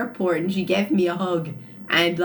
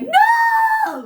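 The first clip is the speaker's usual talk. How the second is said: put-on very high, very loud toddler shriek; toddler's shrieked no; 8 dB louder than usual. The toddler refusing help, shrieking very high and loud.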